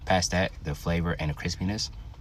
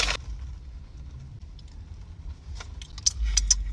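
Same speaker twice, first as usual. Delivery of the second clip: breathy laugh; 5 dB quieter than usual. Real, not acted. Tasting the hot sauce.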